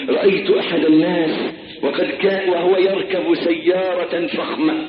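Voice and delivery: normal talking voice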